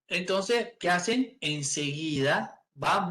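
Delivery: ordinary talk, nothing marked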